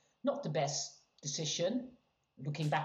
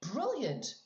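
normal talking voice

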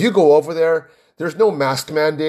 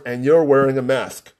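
ordinary delivery

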